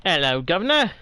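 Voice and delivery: mock Cockney greeting, "Governor" as "guv-uh-nuh"; a hammed-up London accent, said lightly; bad British accent. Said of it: "Bad impression of the accent".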